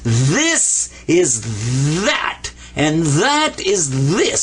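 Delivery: This is that, very slow, stressing the heavy th. Shown as a demonstration. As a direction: drag each th out slowly. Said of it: "give it a heavy T H sound".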